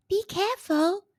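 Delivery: a little fairy cautioning kindly; sweet fairy voice. A narrator's reading. Fairy warns Jack on the cloud, sweetly. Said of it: "it said sweetly".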